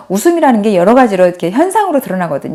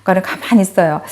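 normal talking voice

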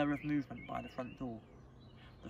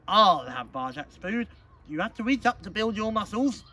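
put-on loud, swaggering young cousin; boastful bully; 7 semitones higher, 12 dB louder than usual. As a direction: boast it loudly, rising high at the end. Boastful cousin takes the food.

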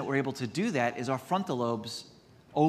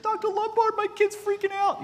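much higher, louder falsetto of a frantic mom on the phone; panicked mother; 5 dB louder than usual. Retold or imitated. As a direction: squeal it like a mother whose kid is losing it. Panicked patient voiced on phone.

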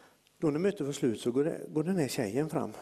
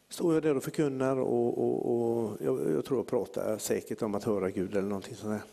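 ordinary delivery

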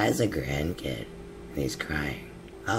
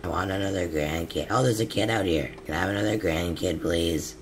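ordinary talk

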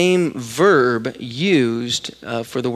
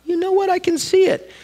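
playing along. Playing along with kids' cloud game.

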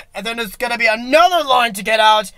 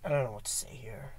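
murmur; 10 semitones lower, 20 dB quieter, faster than usual. Real, not acted. Aside murmured.

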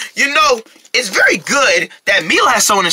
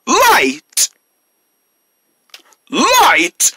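put-on higher, bigger call for kids; kindergarten teacher voice; 6 semitones higher than usual. Shown as a demonstration. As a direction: call it out again, brighter. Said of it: "Say it again".

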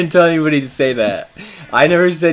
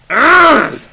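frustrated groan; 10 semitones higher, 4 dB louder than usual. Real, not acted. Frustrated outburst.

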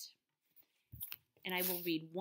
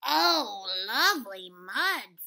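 loud, slow pig voice savoring mud; drawn-out happy pig; hearty pig delight; 13 dB louder, slower than usual. A narrator's reading. Pig character in read-aloud.

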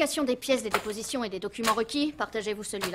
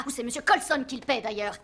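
normal talking voice

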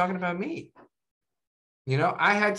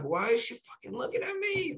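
higher, softer, disbelieving aside; incredulous inner thought; 8 semitones higher, 6 dB quieter than usual. Retold or imitated. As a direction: mutter it to yourself in disbelief. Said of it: "I'm like".

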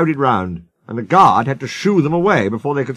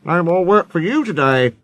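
lightly voiced vexed controller; raised pitch, announcing a decision; stern boss; 5 semitones higher than usual. Controller sends damaged engine home.

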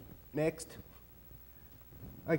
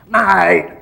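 loud, drawn-out matey shout; big blokey exclamation; hearty mate; 13 dB louder than usual. Acted. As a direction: boom it out to your best mate. Mates make up after a quarrel.

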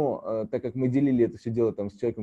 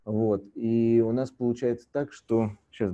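ordinary delivery

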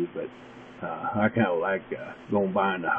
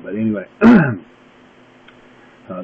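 usual speaking voice

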